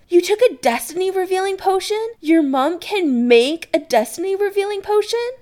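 high, loud, astonished questions; exclaiming. Acted. Adam to Chris about the potion, astonished exclamation. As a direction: squeal it in amazement. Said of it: "he exclaims".

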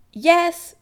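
high, loud, exasperated call. Acted. Chris to his mother, calling. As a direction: call back with an eye-roll. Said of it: "Chris calls".

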